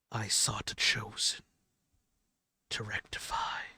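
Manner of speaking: whispery, parched rasp with long pauses; hoarse, dry, strained. Acted. The Ferryman answers the bickering gods, hoarse dry strained whisper. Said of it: "very hoarse, uh, very dry, like, strained voice".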